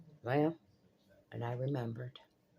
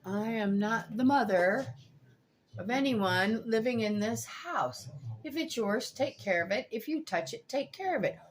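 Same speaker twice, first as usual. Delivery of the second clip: stern note reading; 6 semitones higher than usual. Real, not acted. Reading her stern fridge note.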